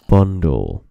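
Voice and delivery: low, loud, deep Bundle; deep mic voice. Shown as a demonstration. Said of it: "if you say it slowly and deeply into a microphone".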